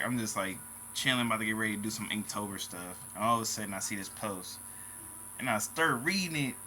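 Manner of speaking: ordinary delivery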